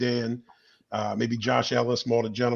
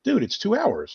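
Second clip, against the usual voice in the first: high, incredulous retort; mock disbelief, way up high; exasperated high-pitched dude; 11 semitones higher, slower than usual. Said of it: "I'm like".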